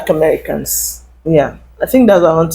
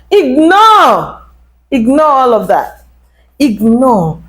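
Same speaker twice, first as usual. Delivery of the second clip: emphatic urging; 4 semitones higher, slower than usual. Real, not acted. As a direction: push IGNORE out high and forceful, falling at the end. Urging viewers to ignore it all.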